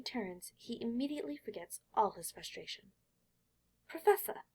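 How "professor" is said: much higher, louder happy call; a boy thrilled to see his tutor; bursting out with joy; delighted cry. Acted. Boy greets beloved teacher.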